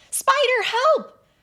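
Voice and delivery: high, very loud panicked shriek; panicked scream. Acted. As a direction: scream for help over a spider. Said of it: "Abby screamed".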